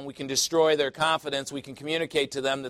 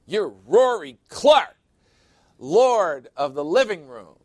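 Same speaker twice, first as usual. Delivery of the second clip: loud, slow, rousing hype-up cheer; movie quote; 3 semitones higher, 9 dB louder, slower than usual. Retold or imitated. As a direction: pump up a friend like a movie speech. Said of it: "That's from Jerry Maguire".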